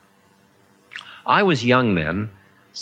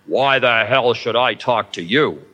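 roared. A narrator's reading. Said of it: "roared above the clacking".

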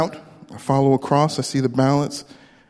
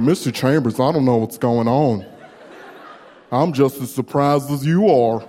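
put-on southern accent; 2 semitones lower than usual. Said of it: "the same accent".

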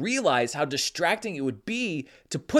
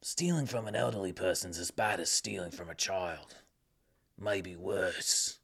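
much lower, soft gravelly tough-guy growl; Jason Statham impression; 10 semitones lower, 10 dB quieter than usual. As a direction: play the hard man, voice dropped low and soft. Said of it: "my Jason Statham impression".

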